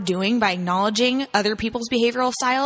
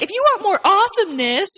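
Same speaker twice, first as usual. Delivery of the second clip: mock hype; 9 semitones higher than usual.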